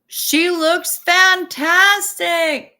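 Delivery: high, loud and stretched out slowly; gushing praise. Real, not acted. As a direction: swoon over the photo, drawing out every word. Gushing over a viewer's mom photo.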